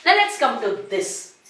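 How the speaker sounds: ordinary talk, nothing marked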